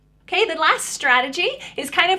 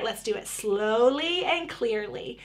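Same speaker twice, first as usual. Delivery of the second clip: "slowly and clearly" drawn out, each word distinct; 3 semitones lower, 4 dB quieter than usual. Acted. Mama prompting her child to say it, slow and clear. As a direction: stretch out "slowly" and pronounce "clearly" with care.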